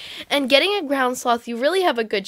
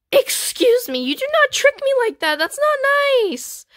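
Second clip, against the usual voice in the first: high-pitched, indignant scolding; mock indignation; 13 semitones higher than usual. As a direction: scold in a squeaky, put-out voice, dragging the last words. Tricked in a trade, scolds player.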